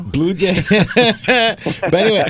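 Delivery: laughing out the name, then slowing as the laugh fades. Real, not acted. Host laughs at the guest's new nickname, laughing. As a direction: chuckle through the words, slow down.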